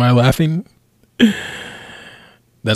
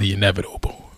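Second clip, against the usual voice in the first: dramatic whisper; 3 semitones lower, 5 dB quieter, faster than usual. Acted. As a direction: whisper it like a doomed villain. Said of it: "so you're Thanos".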